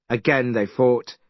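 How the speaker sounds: ordinary delivery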